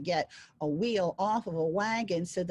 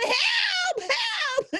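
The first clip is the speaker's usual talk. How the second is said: high, loud crying yell; dragged around by the hammer, screaming for help; 4 dB louder than usual. Acted. Devil dragged by the magic hammer yells for help, high and loud. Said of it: "the poor guy's yelling".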